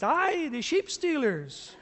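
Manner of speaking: put-on a light Scottish accent, slowed and laughing on "the sheep stealers"; slow laughing Scottish burr. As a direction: tease him through a chuckle like a Scots churchgoer. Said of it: "He goes".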